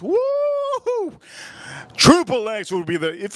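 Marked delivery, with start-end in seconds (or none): 0.0-1.2 excited whoop. Acted. Cheering for Triple X.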